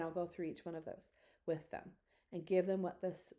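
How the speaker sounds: normal talking voice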